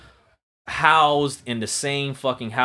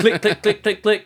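high, louder mock click-language; mock foreign speech; 9 semitones higher, 6 dB louder than usual. Pretending to mock a Rwandan man's language, exaggerated fake clicks. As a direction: spit out pretend clicks as a crude imitation. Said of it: "And I go".

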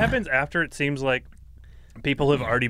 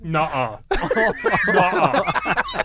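higher, giggly disbelief; laughing mock refusal; 8 semitones higher, 3 dB louder, much slower than usual. Host retelling their reaction to a death, laughing refusal.

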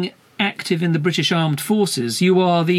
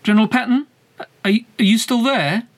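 calling out; 6 semitones higher, faster than usual. Real, not acted. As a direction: call out to someone who may be gone. Calling out after line went silent.

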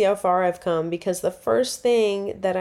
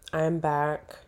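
ordinary talk

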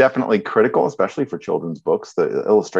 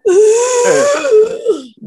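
loud laugh; 8 dB louder than usual. Real, not acted. Big laugh mid-sentence.

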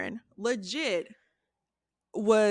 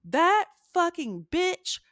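raised, heated retelling of a rant; angry outburst; 6 semitones higher than usual. Retold or imitated. Sister exploding at the fiancee at dinner, heated. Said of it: "she just blew up".

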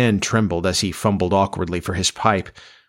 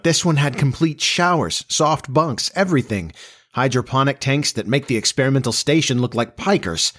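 put-on higher-pitched, enthusiastic young man; eager son, excited; 6 semitones higher than usual. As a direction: reel off the marvels eagerly.

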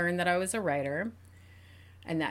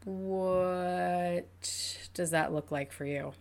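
therapist-like question; 6 dB quieter, much slower than usual. Retold or imitated. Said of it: "And I was like".

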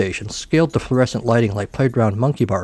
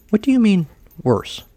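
startled question; 10 semitones higher than usual. Acted. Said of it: "Andreessen said".

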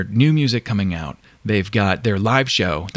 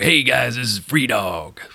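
radio DJ voice; slower than usual. Retold or imitated. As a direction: announce it like an old-time DJ. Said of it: "Listening to Free Dog".

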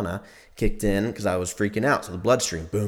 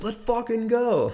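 high, slow, stretched hype shout; mock hype, way up high and drawn out; hyped bro; 13 semitones higher, slower than usual. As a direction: drag it out like a pumped-up bro. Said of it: "Like that".

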